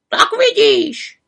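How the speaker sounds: high, slow croaking raven voice. A narrator's reading. Raven scolds the frozen witch, croaking. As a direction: croak it like a know-it-all raven. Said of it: "krákal Havran".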